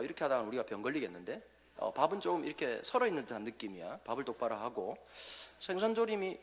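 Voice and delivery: low, quiet, nitpicking husband at the table; nagging husband. Retold or imitated. Preacher voices a husband criticizing his wife's cooking, quiet and low. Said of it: "이런 남편 어떠세요?".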